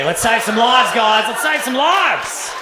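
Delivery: loud, rousing boss cry; rally the team to action; slow shouted rally. Retold or imitated. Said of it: "boss approves ad".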